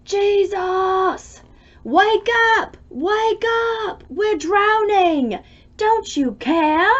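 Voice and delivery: high, loud, desperate calls over the storm; frightened friends yelling him awake; panicked shout. Acted. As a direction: scream for help as the boat fills. Disciples wake Jesus in storm.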